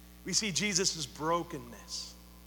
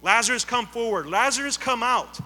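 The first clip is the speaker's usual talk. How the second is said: commanding call, building; 2 semitones higher, 6 dB louder than usual. Acted. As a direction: call Lazarus out of the tomb, louder each time. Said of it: "Jesus commands Lazarus".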